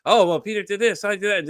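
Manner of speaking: high, loud gossip voice; imitated rumor-mongers. Gossips in a telephone game, imitated excited chatter.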